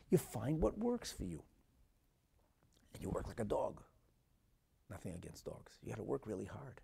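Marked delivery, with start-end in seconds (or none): none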